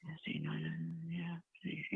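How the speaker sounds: whispered under breath while reading; whispering to self. Real, not acted. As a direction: murmur it to yourself, voiceless. Caller checks statement under breath.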